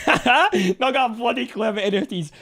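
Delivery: high, loud burst of real laughter; laughing. Real, not acted. Streamer amused by a chat comment, laughing. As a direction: laugh and talk loudly.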